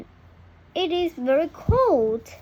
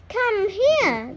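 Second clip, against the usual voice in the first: put-on high child voice calling; children calling; 7 semitones higher, 3 dB louder than usual. A narrator's reading. The man's children call the duckling, high and eager. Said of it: "they say".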